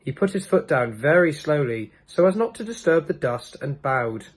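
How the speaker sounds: ordinary delivery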